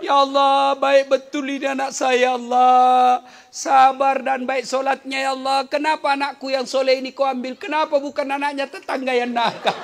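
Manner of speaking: high, loud hammed-up wailing to God; mock lament. Preacher imitates a mother lamenting her son, loud mock wailing. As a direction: overplay a grieving mother's complaint. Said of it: "tidak boleh meratap".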